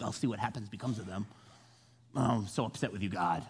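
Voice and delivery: low, soft mock sulk; hammed-up sullen muttering; pouting. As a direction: grumble like a pouting crybaby. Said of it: "He's just pouting".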